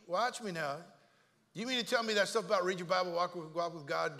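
ordinary talk

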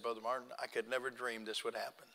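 low, very soft retold tearfulness; tearful, retold. Grateful man to his preacher after family's salvation, understated tearfulness. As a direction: hush it as if moved to tears. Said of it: "he started crying and he said".

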